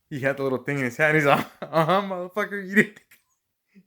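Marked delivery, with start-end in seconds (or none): 1.6-3.8 raised, louder imitation of a gloating friend; loud, teasing retold gotcha; mock gloating. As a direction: play the friend who caught you out. Friend gloats over catching him.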